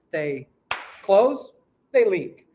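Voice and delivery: teacher hammering the order; stress on close. Shown as a demonstration. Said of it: "teacher stresses sequence".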